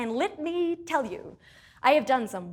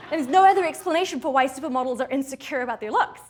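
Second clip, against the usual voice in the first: usual speaking voice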